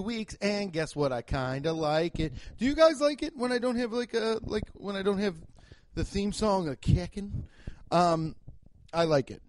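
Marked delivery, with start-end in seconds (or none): none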